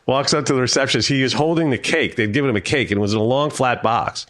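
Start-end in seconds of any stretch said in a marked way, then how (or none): none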